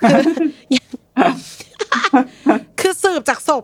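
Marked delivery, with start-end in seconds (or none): 0.2-2.8 a long laughing fit; real burst of laughter. Real, not acted. Host cracking up at the forensic doctor joke, laughing.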